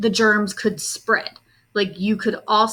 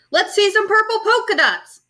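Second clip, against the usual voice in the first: high, louder taunting call; kid yelling; 11 semitones higher, 5 dB louder than usual. A narrator's reading. A classmate yelling out a request at Camilla, high and loud. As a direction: shout it across the classroom. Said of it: "One yelled out".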